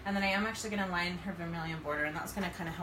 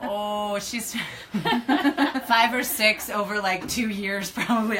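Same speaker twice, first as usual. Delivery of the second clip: laughing; 4 semitones higher, 10 dB louder, much slower than usual. Real, not acted. Laughing at Kylie Jenner question.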